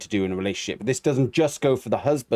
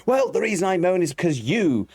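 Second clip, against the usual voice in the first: higher-pitched mock comeback; defensive retort; 6 semitones higher than usual. Coach acts partner answering the moaning accusation, higher defensive retort. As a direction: throw the blame back, pitched up.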